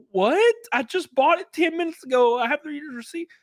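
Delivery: very high, incredulous squeak; baffled protest. Retold or imitated. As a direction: protest innocence in a high, bewildered voice. Baffled customer answers accusation.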